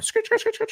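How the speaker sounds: high, squeaky mouth-made scratching; quick vocal scratch effect; imitated record scratch. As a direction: scratch like a DJ changing topics. Record-scratch noise between topics.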